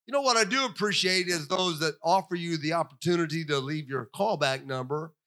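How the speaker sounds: ordinary talk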